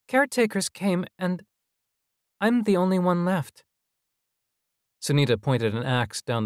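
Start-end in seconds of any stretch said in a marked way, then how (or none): 0.1-3.6 put-on high child's voice, pained; child hologram in grief. A narrator's reading. Hologram telling visitors it alone is left, high and pained. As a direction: lament as a small boy, pitched high.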